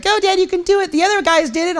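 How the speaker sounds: put-on high-pitched little-girl cheer; imitated daughter. Daughter urging dad up the wall, imitated child voice. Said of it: "She's like".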